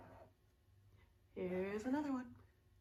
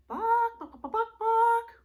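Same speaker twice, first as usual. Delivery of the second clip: high loud bok bok clucks; clucking chicken; 12 semitones higher, 9 dB louder than usual. Shown as a demonstration. Teacher clucking like a chicken for children. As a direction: cluck like a hen for the kids.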